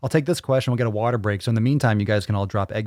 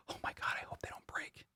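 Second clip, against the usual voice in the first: breathy mock panic over gadgets, whispered; hushed imitation of a nervous owner; mock worried whisper; 20 dB quieter than usual. Mocking a fretful gadget owner.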